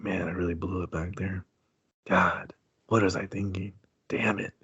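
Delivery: understated mock despair; mock lament. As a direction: sulk it to yourself. Doctor laments on hospital roof.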